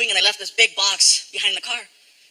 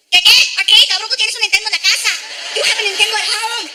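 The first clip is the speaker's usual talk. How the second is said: high, fast Mexican mom scolding; his mom's voice; 10 semitones higher, 5 dB louder than usual. Retold or imitated. Mom refusing the arcade, Mexican mom scolding. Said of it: "flashback to twenty years ago".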